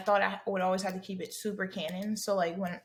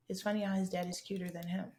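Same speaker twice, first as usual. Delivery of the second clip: ordinary talk, nothing marked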